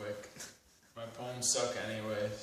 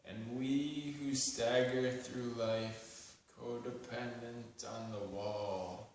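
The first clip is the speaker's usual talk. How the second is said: measured spoken-word poem with long pauses; deliberate poetry reading, lines left to hang; poetry recitation; 5 dB louder than usual. Acted. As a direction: recite it solemnly, phrase by phrase.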